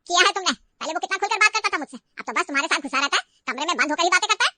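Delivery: loud furious berating; mother-in-law yelling at her crying daughter-in-law; angry. Acted. Mother-in-law berates tearful Riya.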